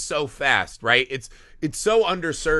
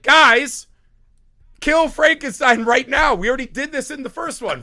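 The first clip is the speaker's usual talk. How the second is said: much higher, louder mock shout; mock yelling at the mob; 10 semitones higher, 6 dB louder than usual. Host shouts at the mob in the movie, high mock yell. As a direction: yell at the dumb mob like a fan at the screen.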